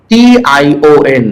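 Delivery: normal talking voice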